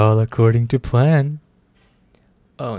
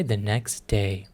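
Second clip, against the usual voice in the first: slow hushed whisper; 3 semitones lower, 6 dB quieter, slower than usual. Real, not acted. Reading the next scene aloud, in a whisper. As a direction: breathe it, slow and secret.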